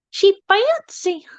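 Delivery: high, slow, drawn-out country-western twang; Teresa's fancy voice. Acted. Mimicking a friend being fancy, high country-western accent. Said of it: "turns into a country westerner when she's talking about being fancy".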